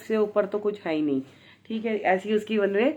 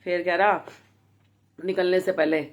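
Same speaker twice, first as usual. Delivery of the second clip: ordinary talk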